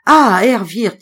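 loud startled exclamation; exclaiming. Acted. Guest calls out to the innkeeper at the door, loud exclamation. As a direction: call out to him in alarm. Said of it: "s'écria Fitz".

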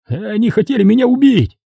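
high-pitched outcry; high, indignant yell; yelled accusation. A narrator's reading. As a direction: cry out the accusation, high and agitated. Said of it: "заорал Серёга".

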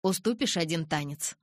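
ordinary delivery

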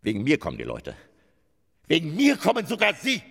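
loud, rising self-important boasting; boastful outburst. Acted. Vain actor lecturing the stagehand, loud and boastful. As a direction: puff yourself up and proclaim it.

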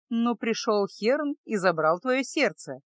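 usual speaking voice

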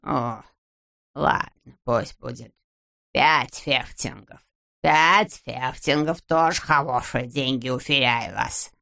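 low, slow, reluctant haggling; grudging concession. A narrator's reading. Miser raises his offer slightly, grudging drawl. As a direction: give in heavily, dragging each word.

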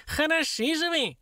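very high, drawn-out reproach; high squeaky outburst; dwarf's outraged wail. A narrator's reading. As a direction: wail it like a furious tiny imp. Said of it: "завопил карлик".

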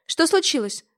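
высоко и громко, в тревоге; спросить в панике; испуганно. A narrator's reading. Мать в испуге. (мать в испуге спрашивает сына)

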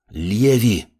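mildly growled warning. A narrator's reading. Apprentice warning girlfriend off, mild growl. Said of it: "рыкнул маг".

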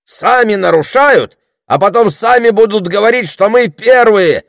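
high, loud accusation, slowing at the end; indignant. A narrator's reading. Orator blaming the enemy for explosions, indignant. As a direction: denounce the other side publicly. Оратор обвиняет врага во взрывах.

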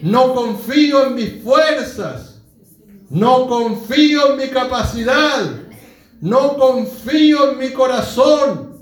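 loud, slow, rising sermon climax; impassioned preaching. Real, not acted. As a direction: proclaim each phrase at full force. Preaches at full force.

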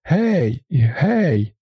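high call to a distant tractor; calling out far. A narrator's reading. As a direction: shout for help into the distance. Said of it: "Я кричу маленькому тракторові вдалині". (гукання вдалину)

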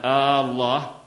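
الله with a long opening آه, as a question word; lengthened first vowel, آه الله; stretched hamza. Shown as a demonstration. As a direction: draw out آه before الله. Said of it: "استفهاما".

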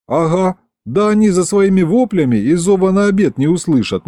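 usual speaking voice

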